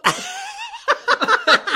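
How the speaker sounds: very high outraged burst of laughter; shrieking loud laugh. Real, not acted. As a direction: cackle at the insult. Offended by seventies jab.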